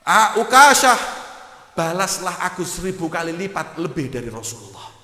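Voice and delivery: very loud, high pleading cry; impassioned outcry. Acted. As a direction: throw yourself forward, begging. Abu Bakar offers himself instead. (seruan penuh emosi yang keras)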